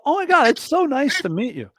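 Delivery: starstruck. Retold or imitated. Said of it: "I had a moment where I did get starstruck".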